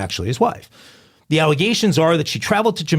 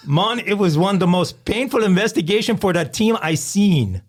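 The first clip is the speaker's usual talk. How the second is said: higher Jamaican accent; 6 semitones higher than usual. Shown as a demonstration. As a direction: quote the police chief in a Jamaican accent. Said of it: "give us a Jamaican accent".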